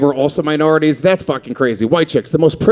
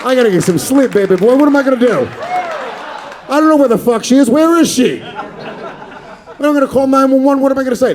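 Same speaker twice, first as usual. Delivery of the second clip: high, frantic mock panic; hammed-up frantic worrying; panicked; 9 semitones higher than usual. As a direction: flap around like a scared neighbour. Panicking at the window at night.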